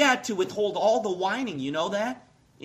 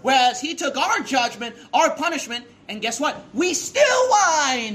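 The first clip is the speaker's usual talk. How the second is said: louder and higher, exploding on the last words; outraged preaching; 5 semitones higher, 5 dB louder than usual. Real, not acted. Preacher rebuking the congregation for whining, outraged shout.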